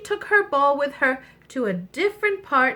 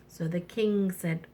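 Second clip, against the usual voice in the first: usual speaking voice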